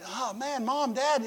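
mock-whine like a kid in pain; whining daughter. Daughter complaining to her parents, a hint of whining. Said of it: "She starts whining and complaining".

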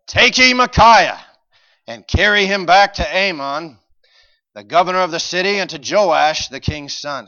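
loud, high bellow of a king's order; shouted royal command. Acted. King ordering the prophet taken away, shouted. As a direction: thunder it out like an enraged ruler.

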